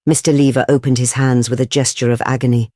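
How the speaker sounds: plain reading voice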